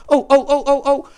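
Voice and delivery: bursting to say something, keen; excited oh oh. Acted. Puppet eagerly interrupts the host, excited.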